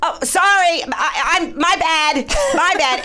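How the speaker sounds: high, panicky mock yell; retold yelling. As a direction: call out an apology across a store. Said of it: "I'm yelling this at, through the store".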